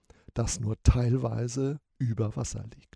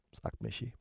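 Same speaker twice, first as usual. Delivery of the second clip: ordinary delivery, nothing marked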